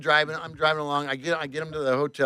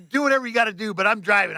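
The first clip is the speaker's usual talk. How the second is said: raised, heated voice; defiant; 6 semitones higher, 5 dB louder than usual. Retold or imitated. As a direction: argue back and refuse to budge. Retold defiance of boss.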